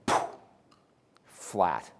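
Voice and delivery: sudden low hush; hushed flat. Acted. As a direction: drop the voice to nothing, like still water. Waves suddenly calm.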